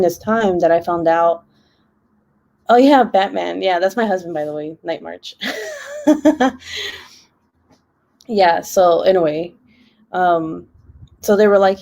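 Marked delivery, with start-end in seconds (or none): 5.4-6.9 real laugh, high up; high burst of laughter. Real, not acted. Laughs after naming husband.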